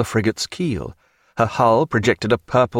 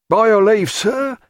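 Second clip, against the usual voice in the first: high, loud seaman calling down; high hailing sailor voice; sailor crying out; 11 semitones higher, 3 dB louder than usual. A narrator's reading. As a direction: call out to wake the sleeping doctor. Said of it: "cried the captain of the afterguard".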